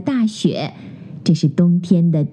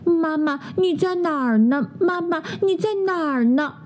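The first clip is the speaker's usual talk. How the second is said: higher, softer little cub calling for mum; a lost little cub searching for mum in the dark; 7 semitones higher, 4 dB quieter than usual. Acted. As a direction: cub anxiously calls for mum. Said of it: "小熊喊道".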